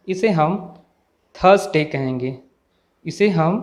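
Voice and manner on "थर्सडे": थर्सडे with no r sound; silent r. Shown as a demonstration. Teacher showing the correct word, r left silent. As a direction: drop the r in थर्सडे. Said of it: "आर की sound नहीं आती है".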